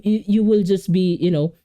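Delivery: ordinary talk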